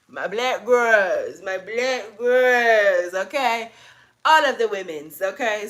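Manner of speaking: slow, drawn-out, loud hype call; loud shout-out. Real, not acted. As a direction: stretch it out and hype them up.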